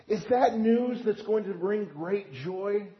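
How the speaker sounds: ordinary talk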